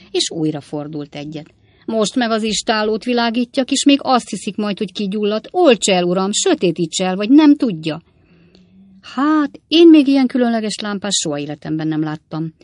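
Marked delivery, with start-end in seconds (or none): 1.8-8.0 higher, louder urgent warning; alarmed scolding. A narrator's reading. Sam frantic about straying lantern beam. 9.0-12.5 loud, astonished outcry; exclamation. A narrator's reading. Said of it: "kiáltott fel Pickwick úr".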